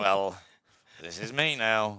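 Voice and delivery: slow, loud, deadpan resignation; resigned quote. Retold or imitated. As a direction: say it like accepting defeat. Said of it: "and then I'm like".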